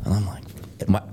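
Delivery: ordinary delivery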